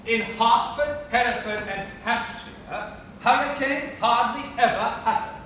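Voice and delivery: every H sounded in Hertford, Hereford, Hampshire; measured model, puffed H in hurricanes and happen; clearly breathed H's. Shown as a demonstration. Professor asks pupil to repeat, clearly breathed H's. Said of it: "Now, repeat after me".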